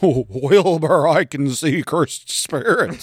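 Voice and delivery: high, slow talking horse voice; Mister Ed. Pretend. Talking horse confiding in Wilbur about spirits, goofy impression. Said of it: "It sounds like Mister Ed".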